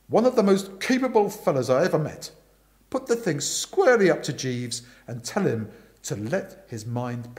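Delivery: loud, forceful Aunt Dahlia voice; bossy aunt telling Bertie the gossip, loud and brisk; booming aunt. Acted.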